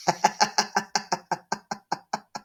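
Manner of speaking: breathless evil cackle; devilish cackle. Acted. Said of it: "laughed the cackle of the devil".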